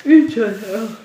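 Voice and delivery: speaking through a yawn; words stretched by a real yawn. Real, not acted. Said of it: "les amis, je baille".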